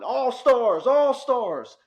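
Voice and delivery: high, loud stadium yell. Retold or imitated. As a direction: call out to the players at full volume. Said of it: "Stats and I are yelling".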